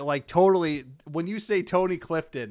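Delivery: ordinary talk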